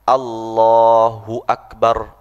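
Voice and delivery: slow, every letter of "Allahu Akbar" heard; full correct takbir. Shown as a demonstration. Teacher demonstrating the right takbir, slow. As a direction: stretch "Allahu Akbar" slowly, full A at the start. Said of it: "kita dengar sendiri".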